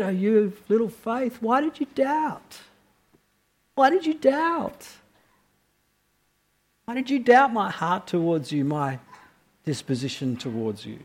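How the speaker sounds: Jesus gently asking Peter why he doubted, softly; gentle, not rebuking. Retold or imitated. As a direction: ask it kindly, with no rebuke. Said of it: "I don't think in a really rebuking way".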